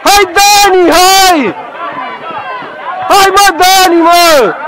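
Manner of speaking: high, insistent sideline yell; urgent calling out. Real, not acted. Coach urges Dani on during play, calling out urgently. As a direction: urge the player on from the sideline.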